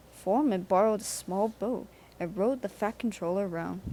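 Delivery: ordinary delivery, nothing marked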